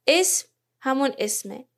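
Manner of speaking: ordinary talk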